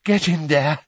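high, loud, winded order; panting. A narrator's reading. Weasley pointing Harry at the door, panting. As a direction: urge him in while catching breath. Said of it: "he panted".

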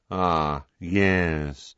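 low, drawn-out mournful drone; sepulchral. A narrator's reading. Mournful wizard eyeing Harry, sepulchral. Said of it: "in a sepulchral voice".

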